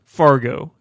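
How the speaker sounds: ordinary delivery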